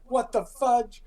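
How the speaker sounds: acted affronted townsperson; put off. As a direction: jump up in pitch, taken aback. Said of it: "put off".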